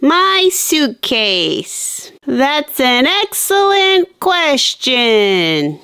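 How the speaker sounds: very slow, stretched-out words; odd, overcontrolled slowness. Acted. Girl trying not to freak out, answering with strained slowness. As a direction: draw every word out to hold off a freak-out. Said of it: "why are you talking like that".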